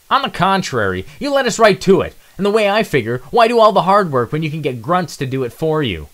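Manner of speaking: very high, loud sneering voice; mocking villain. Nitro mocks Zero's squad, high sneering voice. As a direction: sneer down at the grunts. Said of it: "derogatory joke".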